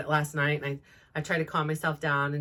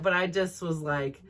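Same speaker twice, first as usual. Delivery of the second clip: usual speaking voice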